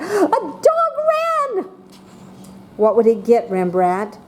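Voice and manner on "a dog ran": high, slow, thrilled announcement; excited exclamation demo. Shown as a demonstration. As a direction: make it sound amazing. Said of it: "What kind of mark would it get".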